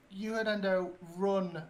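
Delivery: in-character shout, moderately loud; shouted order. Acted. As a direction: call out a warning to a companion. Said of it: "I'll shout".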